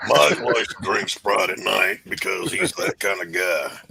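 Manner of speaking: slow Southern drawl, "Mike" said as "Mahk"; Texas accent. Retold or imitated. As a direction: drawl it out like a Texan. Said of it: "if they're from Texas".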